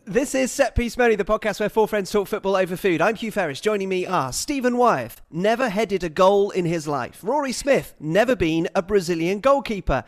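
upbeat show intro read; podcast host intro. Acted.

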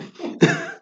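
a quick high chuckle; real amused laugh. Real, not acted. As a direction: chuckle at the punchline. Laughs at his own story.

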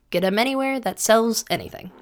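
hammed-up commercial voice; ad read. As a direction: play it like a cheesy radio ad. Mock sponsor ad read.

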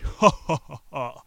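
loud, high first burst, then falling away; mocking demon laugh. A narrator's reading. Demon laughs at the young man, loud staged mockery. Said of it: "began laughing in mockery".

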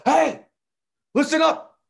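high, loud shout to a class; retold teacher's yell. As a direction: yell for the whole room's attention. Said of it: "I gotta yell".

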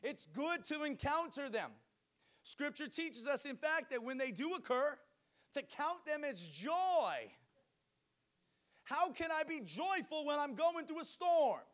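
loud, high-pitched fiery preaching; booming motivational speech. Acted. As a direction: preach it out to the whole church. Preaching at full force.

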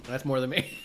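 ordinary delivery

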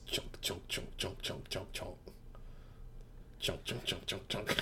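soft chomping sound effects; bug chomping noises. Retold or imitated. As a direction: mouth the bugs munching. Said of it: "That's the sound of the bugs".

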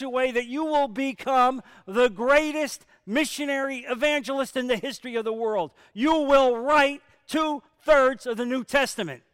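loud, high fervent preaching. Acted. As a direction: drive it home to the congregation. Preaching at full force.

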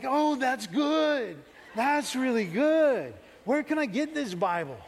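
high, drawn-out savoring of the words; hammed-up, thrilled discovery; delighted amazement. Boy finally understanding the pastor's sermon, hammed-up delighted excitement.

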